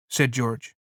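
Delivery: ordinary delivery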